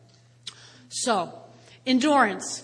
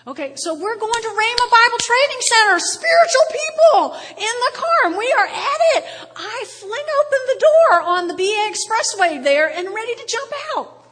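ordinary delivery

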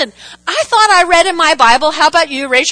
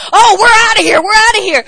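higher, louder mock alarm; mock panicked crowd; 7 semitones higher, 5 dB louder, faster than usual. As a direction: play the crowd scrambling to flee. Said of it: "people ... going".

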